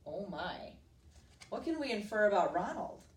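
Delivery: ordinary delivery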